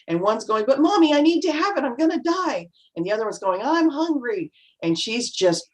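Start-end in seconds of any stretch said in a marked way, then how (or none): 0.7-2.7 put-on high, whining child begging; imitating a child demanding something at the mall; whiny kid voice. Kids acting up in mall. 3.6-4.5 put-on high, whining child complaint; imitating a tired child whining to mom; whiny kid voice. Other child in the mall.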